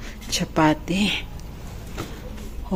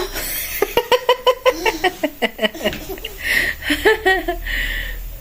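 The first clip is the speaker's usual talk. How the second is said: 1 breathy laugh; 8 semitones higher, 6 dB quieter than usual. Real, not acted. Silent laugh.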